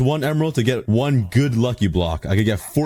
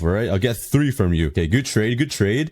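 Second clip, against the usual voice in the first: ordinary talk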